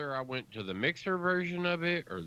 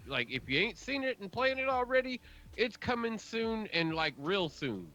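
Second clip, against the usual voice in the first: hype man voice; 9 semitones higher than usual. Acted. Hyped mock announcement of games.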